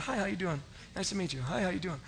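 soft, polite, rehearsed hello; polite formal greeting. Retold or imitated. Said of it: "it's supposed to be, you know, shake his hand".

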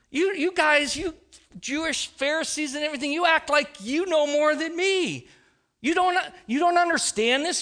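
incredulous, high-pitched hammed-up sarcasm; mock sarcastic outburst. Preacher playing a sarcastic Jesus to the Pharisees, mock incredulous. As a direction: play disbelief at know-it-alls, voice jumping high. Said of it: "could be sarcastic or funny".

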